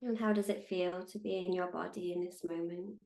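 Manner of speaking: calm, unhurried meditation guide with long pauses; measured, soothing meditation voice; guided meditation. Acted. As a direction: guide them gently into stillness, leaving space between phrases.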